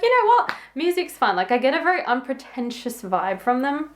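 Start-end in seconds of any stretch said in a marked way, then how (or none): 0.0-1.3 carefree excited quip. Retold or imitated. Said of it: "just be like".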